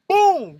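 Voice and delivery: a sudden high, loud BOOM like a knockout blow; shouted boom. Retold or imitated. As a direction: slam the word out at full force. Landing the big punch.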